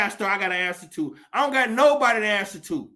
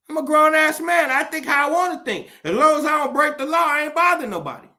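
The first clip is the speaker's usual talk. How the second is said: defiant outburst; 6 semitones higher, 4 dB louder, much faster than usual. Real, not acted. Defiant rant against government.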